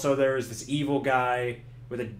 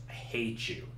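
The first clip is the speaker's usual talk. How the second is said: flat muttered hate; 2 semitones lower, 9 dB quieter than usual. Acted. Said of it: "Cunningham's like".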